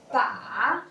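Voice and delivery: "ba" dipping low, then turning up; dipping third tone. Shown as a demonstration. Teacher models tricky third tone, falling then rising. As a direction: drop "ba" down and bend it back up. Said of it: "That third tone".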